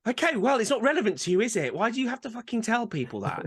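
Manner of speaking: exasperated, high-pitched rant; pissed off. Real, not acted. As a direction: let real irritation push the pitch up. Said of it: "It pisses me right off".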